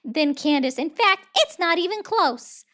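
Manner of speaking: loud, high-pitched ranting impression; high, forceful mock-presidential rant; Donald Trump. Streamer reading a rambling Trump post, high-pitched impression. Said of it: "I cannot do a Trump voice ... which is a high-pitched voice".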